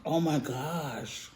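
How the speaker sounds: slow soft oh my gosh; hushed awe. Real, not acted. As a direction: breathe it out in awe.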